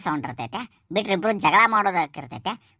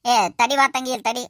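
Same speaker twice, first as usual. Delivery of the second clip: loud, high call to stop her; 8 semitones higher, 4 dB louder than usual. Acted. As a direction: shout after her so she halts. Father-in-law stops daughter-in-law leaving. (ಎತ್ತರದ ಧ್ವನಿಯಲ್ಲಿ ಕೂಗಿ ತಡೆಯುವುದು)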